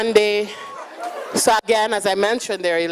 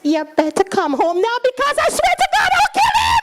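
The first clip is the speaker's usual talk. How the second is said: mock furious yell; faster than usual. Daughter shouting to dad over the phone, furious yell. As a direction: yell it like a big sister at her limit.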